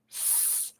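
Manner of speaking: "S" as a soft unvoiced hiss, sss; letter sound S. Shown as a demonstration. Teacher demonstrates the first sound of S, a soft hiss. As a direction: hiss a quiet "S". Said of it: "S makes two sounds".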